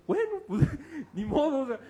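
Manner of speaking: high, laughing shrug. Real, not acted. Preacher laughs off being blocked.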